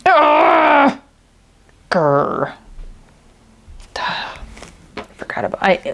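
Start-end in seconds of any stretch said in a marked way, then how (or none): 0.1-1.0 short, high, loud cry of frustration; higher, louder burst of annoyance; frustrated yell. Real, not acted. Frustrated by a craft mishap. 1.9-2.5 low growl of annoyance; real grumbly, lower-pitched "grr"; frustrated growl. Real, not acted. Frustrated by a craft mishap.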